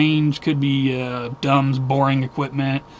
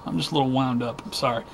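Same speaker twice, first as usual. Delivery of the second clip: ordinary delivery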